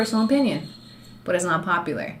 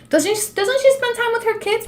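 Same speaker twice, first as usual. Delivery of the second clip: mock critic; 14 semitones higher than usual. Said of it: "mocking critics' questions".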